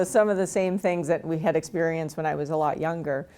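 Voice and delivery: normal talking voice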